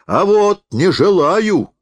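high, loud and slow; shouted refusal. A narrator's reading. Refuses again, more forcefully.

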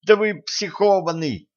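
shrill high outburst; blurting out. Acted. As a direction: blurt it out in shock. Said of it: "выпалила Женька".

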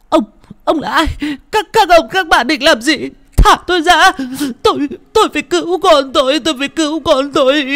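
high, loud, panicked crying plea; frantic struggle. Acted. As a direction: fight them off in frenzy. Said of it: "chống cự quyết liệt, điên cuồng".

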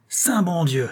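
furious father's exclamation; terrible cry. A narrator's reading. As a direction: cry out in fury. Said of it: "s'écria-t-il avec un accent terrible".

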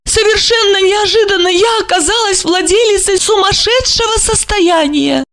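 a pampered widow showing off; high mannered female voice; Olga's voice. A narrator's reading. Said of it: "Olga's voice".

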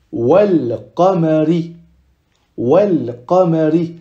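slow wal qamari, lam sounded; lam pronounced. Shown as a demonstration. Teacher reading the first example, lam pronounced. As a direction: hold the L of wal before qamari. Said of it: "on prononce le lam".

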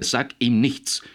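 loud, slow, urgent interruption; hasty urgent cut-in. A narrator's reading. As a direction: snap in to stop him talking. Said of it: "unterbrach Foster hastig".

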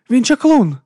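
loud, high-pitched accusation; angry outcry. A narrator's reading. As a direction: cry out from an angry crowd. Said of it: "гнівних вигуків".

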